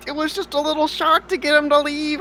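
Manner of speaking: high, pleading character voice; nervous apology. Acted. Adventurer pleading with the angry farmer, high and flustered. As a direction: beg forgiveness in a squeaky panic.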